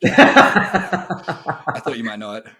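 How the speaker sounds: laughing through the words; chuckling at himself. Real, not acted. Laughing at own Japanese.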